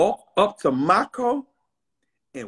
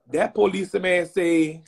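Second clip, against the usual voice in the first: ordinary talk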